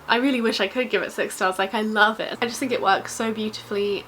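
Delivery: usual speaking voice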